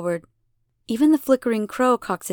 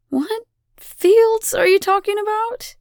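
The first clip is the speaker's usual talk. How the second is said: high, loud, incredulous question; baffled irritation; 13 semitones higher, 5 dB louder than usual. A narrator's reading. Elena cannot recall any fields, baffled and loud. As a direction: demand it in bafflement.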